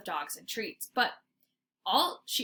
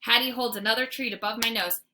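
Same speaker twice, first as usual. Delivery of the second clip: ordinary delivery, nothing marked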